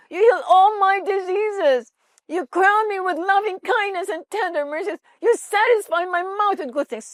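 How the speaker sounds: high, loud, tearful outcry, slowed at first; crying shout. Retold or imitated. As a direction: shout the verses through tears. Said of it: "Sumisigaw daw siya".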